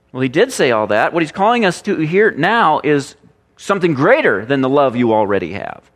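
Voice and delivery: ordinary talk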